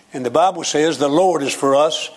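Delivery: ordinary talk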